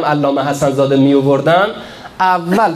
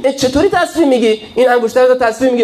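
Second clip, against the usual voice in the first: high, fast mock disbelief; incredulous mock question, pitched up and rushed; mocking doubt; 9 semitones higher, faster than usual. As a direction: ham up the scoffing sceptic.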